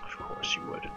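whispered, sour sarcasm; bitter whisper. Real, not acted. Player whispering sarcastically at the enemy. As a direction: breathe it out resentfully.

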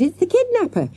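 high excited alarm; shouted. A narrator's reading. Girl pointing out the man to classmates, shouted. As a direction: cry out the identification in a high voice. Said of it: "she shouted".

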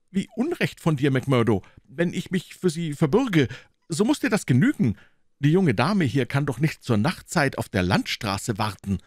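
high, quick fussy gentleman's voice; a fretful little man insisting at the gate; Thaddeus, high and hurried; nervous gentleman. Acted. Thaddeus pleads.

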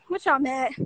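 normal talking voice

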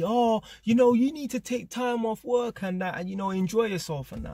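high mock voice of a lecturing neighbour; mocking the woman. Mimicking the woman who told him to enjoy himself, high and mocking.

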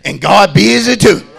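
loud, high, rhythmic sermon chant; shouted preaching. Acted. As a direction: drive every line home over the music. Preaching at full force.

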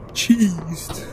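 ordinary delivery

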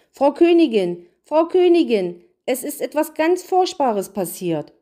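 high, loud alarmed calling; agitated. A narrator's reading. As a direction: burst in with urgent, high-pitched news. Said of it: "rief er aufgeregt".

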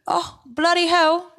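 slow, high mock British accent; hammed-up British exclamation. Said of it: "a British accent".

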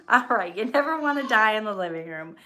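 repeats the punchline while laughing; laughing through it. Real, not acted. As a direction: give in and laugh out the line. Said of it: "Okay, I'll laugh".

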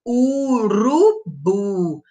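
Urubu drawn out very slowly; slow teaching word. Shown as a demonstration. Teacher modelling urubu slowly for children. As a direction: stretch out urubu for the class. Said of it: "começa com a letrinha U".

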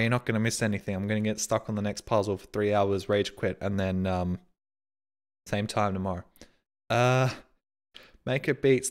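ordinary talk, nothing marked

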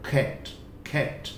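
"खैट, खैट" whispered with a soft breathy k; "खैट" breathed out, soft kh. Shown as a demonstration. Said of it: "हल्का सा soft ख".

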